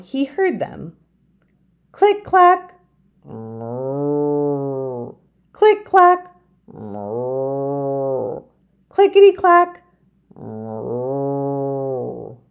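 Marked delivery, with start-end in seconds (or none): 1.9-12.4 singsong typewriter and cow; click clack moo. Acted. Typing sound and cow moo.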